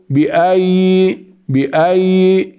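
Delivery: بأي with single y and long ee; wrong madd. Shown as a demonstration. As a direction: stretch the ending of بأي. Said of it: "لا". (بأي بالمد خطأ)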